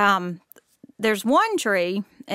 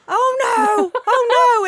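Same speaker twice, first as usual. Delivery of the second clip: high, louder panicked cry; panicked wail; 13 semitones higher, 7 dB louder, slower than usual. Retold or imitated. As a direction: wail in dismay. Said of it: "So I'm like".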